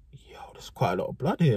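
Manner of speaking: whispered, uneasy reaction; hushed shock. Retold or imitated. Seeing pools of blood outside class, hushed shock. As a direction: half-whisper it to yourself, taken aback. Finding blood, muttering to himself.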